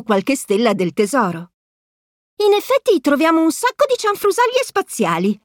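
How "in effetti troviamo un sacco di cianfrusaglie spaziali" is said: much higher squeaky chipmunk voice; moon chipmunks. A narrator's reading. Moon chipmunks answer Mickey, squeaky chatter.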